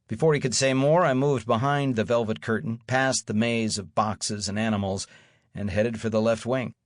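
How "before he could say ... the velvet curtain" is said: ordinary delivery, nothing marked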